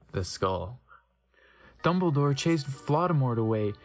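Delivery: ordinary delivery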